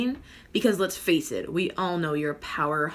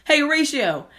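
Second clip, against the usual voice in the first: loud, raised-pitch holler; calling out; 9 semitones higher, 8 dB louder than usual. Acted. Host calling a helper for wine, loud holler. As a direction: call out to someone across the room.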